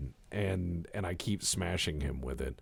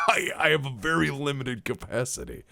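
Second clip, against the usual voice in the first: high-pitched, laughing admission; 6 semitones higher than usual. Real, not acted. Admitting to friend he likes few things, laughing. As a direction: confess it through a laugh, voice pitched up. Laughing at his own limitation.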